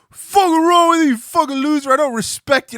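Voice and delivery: mock yell of online haters; mock angry tweets. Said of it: "people going".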